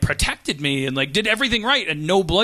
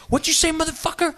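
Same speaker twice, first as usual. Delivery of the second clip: mock tough guy threat; 10 semitones higher, faster than usual. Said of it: "and he goes like".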